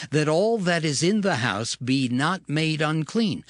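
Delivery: ordinary delivery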